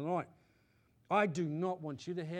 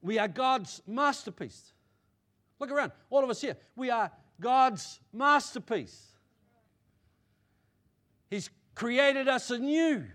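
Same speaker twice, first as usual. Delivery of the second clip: emphatic preaching; 5 semitones higher, 6 dB louder than usual. Real, not acted. Preacher telling all present they are God's masterpiece, emphatic proclamation. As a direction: proclaim it forcefully, drawing out key words.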